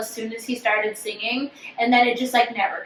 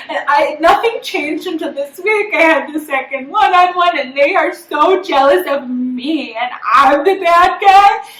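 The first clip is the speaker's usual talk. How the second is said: higher, slow, put-on complaining voice; whiny imitation; 4 semitones higher, 4 dB louder than usual. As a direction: mimic her self-pity, drawn out. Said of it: "she's like".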